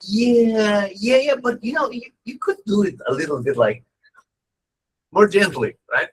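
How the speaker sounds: mock meek disciples asking for gentleness; high pleading students. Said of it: "They're like".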